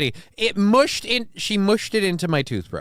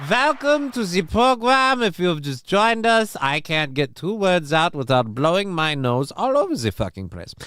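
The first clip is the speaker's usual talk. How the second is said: put-on German accent; 3 semitones higher than usual. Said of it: "do that in a German accent".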